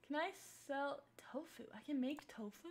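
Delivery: whispering. Real, not acted. Whispering to herself.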